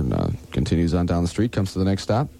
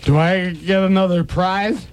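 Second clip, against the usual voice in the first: put-on high, slow drunk voice; 9 semitones higher, slower than usual.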